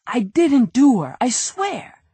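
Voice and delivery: high, pleading small-time crook; a nervous crook begging his innocence; whiny crook voice. Acted. Nervous crook pleading with detective Andy, high and whiny. Brady voice.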